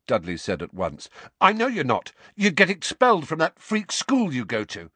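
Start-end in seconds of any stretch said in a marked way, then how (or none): 1.4-4.9 high, louder cocky retort. A narrator's reading. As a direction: fire back smugly. Harry retort.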